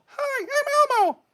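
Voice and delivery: very high, slow Elmo voice; Elmo impression. Viewer asks him to be Elmo, squeaky-high Elmo impression. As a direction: say hello in a high-pitched, drawn-out Elmo. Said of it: "Be Elmo".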